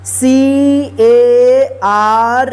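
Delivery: letters spelled out slowly one by one; slow spelling. Shown as a demonstration. Teacher spells word letter by letter.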